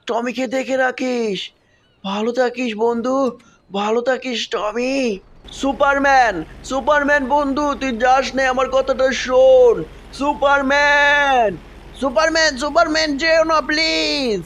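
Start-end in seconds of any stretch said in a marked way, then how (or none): 5.6-14.4 a little friend crying out for Superman to stay; desperate calling. Acted. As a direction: beg him to come back. Begging friend not to leave.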